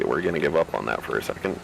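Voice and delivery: ordinary delivery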